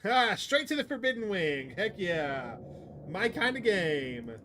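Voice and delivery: loud, high triumphant cheer; gleeful excitement. Real, not acted. Streamer cheering the Forbidden Wing choice, gleeful.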